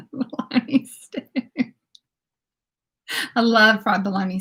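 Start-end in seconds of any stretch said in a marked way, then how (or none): 0.0-1.2 breathy whisper through laughter; laughing. Shown as a demonstration. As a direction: laugh out the name.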